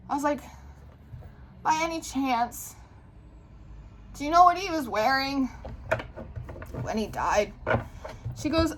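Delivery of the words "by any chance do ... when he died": inch toward it with long pauses; retold hesitant question. Retold dreading question about the boy.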